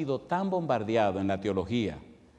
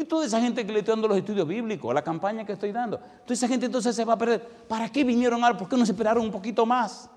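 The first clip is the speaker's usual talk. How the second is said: pleading complaint; 9 semitones higher, faster than usual. Retold or imitated. Arguing with the angel in dream.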